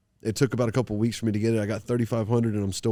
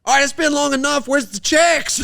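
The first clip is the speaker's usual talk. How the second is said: shouting from the back, pitched way up; loud demand; 14 semitones higher, 6 dB louder than usual. Retold or imitated. Fighter yelling to the promoter for his pay, loud and high. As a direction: call out loud and high for the checks. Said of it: "I'm a loud person".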